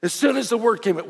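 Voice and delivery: ordinary talk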